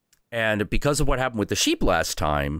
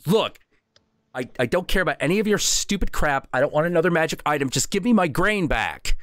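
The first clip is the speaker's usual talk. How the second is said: put-on high, fed-up little boy voice; a kid who's done with it, pitched up; indignant kid; 8 semitones higher than usual. Little Viking demanding his grain from the Northwind, high and fed up.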